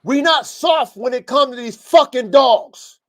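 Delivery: loud, slow, hammering each word; slow coach's bellow, every word pounded; aggressive coach shout. Real, not acted. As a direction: shout it at the team like a fired-up coach. Coach rants about being aggressive.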